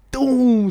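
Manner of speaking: loud, high boom sound effect; imitated impact. Telling friend how Danny hit the seat, loud imitated boom. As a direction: punch out a crash noise.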